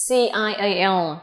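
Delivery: ordinary delivery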